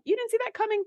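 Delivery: a sarcastic you-didn't-see-it question; mock disbelief. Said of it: "part of me is kinda like".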